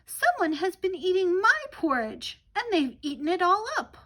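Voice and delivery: the smallest bear, wailing; Baby Bear upset his porridge is all gone, high and loud; tiny little Baby Bear voice. Acted. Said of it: "cried out in his tiny little voice".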